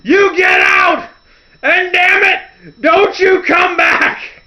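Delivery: very high, loud, slow screaming order; Vince McMahon yelling. Retold or imitated. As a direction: scream him out of the ring. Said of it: "he's like".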